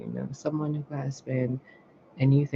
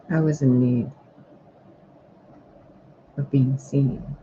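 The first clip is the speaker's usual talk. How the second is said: channelled message; 4 dB louder than usual. Acted. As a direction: deliver it phrase by phrase, weighty and emphatic.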